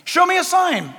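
high, insistent mock plea; pleading believer. Acts pleading believer versus God.

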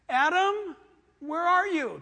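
high, loud call to a hiding man. Acted. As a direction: call out like God searching the garden. God calling out to Adam.

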